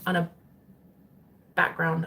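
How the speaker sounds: ordinary talk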